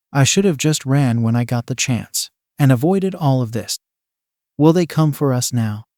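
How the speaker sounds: plain reading voice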